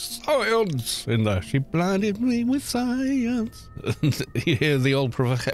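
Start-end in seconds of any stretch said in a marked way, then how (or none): 0.0-1.1 Magnus Pike impression. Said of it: "Magnus Pike was the one that goes". 1.4-3.7 high, slow, drawn-out sample voice; quoting the song sample. Retold or imitated. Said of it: "Magnus Pike was the one that goes".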